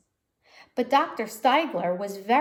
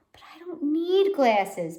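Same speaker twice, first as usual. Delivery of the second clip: higher, loud whiny protest; protesting child; 7 semitones higher, 5 dB louder than usual. Acted. Girl refusing her new glasses, whiny protest. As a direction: whine it like a reluctant kid.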